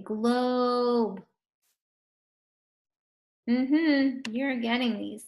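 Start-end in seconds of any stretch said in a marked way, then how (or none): 0.0-1.2 "globe" drawn out on a long o; slow sounding-out. Shown as a demonstration. Teacher lets pupils hear the middle sound, slow "globe". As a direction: hold the vowel in "globe". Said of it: "Make sure you say it slow".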